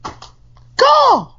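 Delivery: loud, very high caw; one sharp crow screech; high-pitched mock bird call. Co-host answers with bird call.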